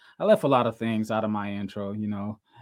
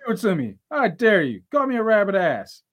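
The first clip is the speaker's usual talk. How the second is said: louder, higher taunting dare; 8 semitones higher, 9 dB louder than usual. Acted.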